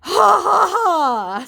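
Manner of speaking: high, loud manic ha-has; the scammer's unhinged mocking laugh. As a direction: cackle like he's lost it. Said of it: "still with the unhinged laughing".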